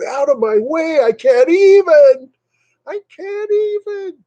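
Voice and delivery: high-pitched, hammed-up frantic yell; mock road rage. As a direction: screech it slowly like an exasperated driver. Acts her honking through red lights.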